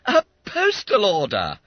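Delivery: high, loud amazement; astonished exclamation. Acted. Schoolboy seeing money in the letter, astonished. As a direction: announce it in disbelief.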